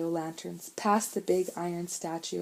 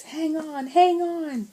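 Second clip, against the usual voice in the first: high, urgent yell building louder; 11 semitones higher, 6 dB louder, slower than usual. A narrator's reading. Big brother yells to the others as the floe rolls. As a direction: shout to hold on. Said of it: "yelled Sean".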